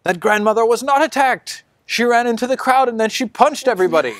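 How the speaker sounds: very high accusing voice; accusing bystander. Acted. Bystander accuses the grandmother, very high. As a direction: tattle on her.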